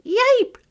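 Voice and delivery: high, sharp yelping squeal; puppy yelp of terror. A narrator's reading. Puppy yelps in terror when scratched by the kitten. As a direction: yelp as if a claw just scratched you. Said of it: "squealed Barkus in terror".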